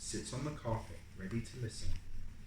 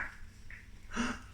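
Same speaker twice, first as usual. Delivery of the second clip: unvoiced gasp; 12 semitones higher, 9 dB quieter than usual.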